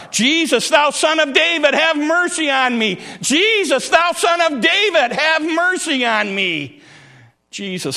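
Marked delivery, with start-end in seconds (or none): 0.1-6.8 crying out more. Acted. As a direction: cry out even harder for mercy. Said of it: "he cried so much the more".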